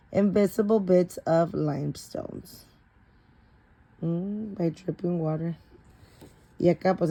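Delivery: plain reading voice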